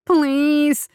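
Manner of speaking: high, loud desperate plea; pleading. Acted. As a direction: beg in terror. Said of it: "he tried to plead".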